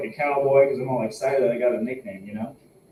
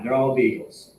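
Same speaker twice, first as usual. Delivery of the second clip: ordinary talk, nothing marked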